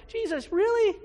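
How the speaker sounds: high, loud, exasperated disbelief; incredulous disciples. Retold or imitated. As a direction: scoff at Jesus as if it were obvious. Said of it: "he's like".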